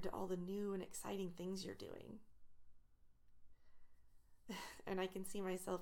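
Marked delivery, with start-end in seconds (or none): 3.3-4.8 soft breathy laugh. Real, not acted.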